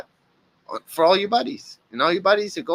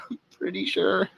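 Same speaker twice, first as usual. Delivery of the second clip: laughing through it, higher; 5 semitones higher, 4 dB quieter than usual. Real, not acted. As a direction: chuckle out the words. Laughing then repeating the line.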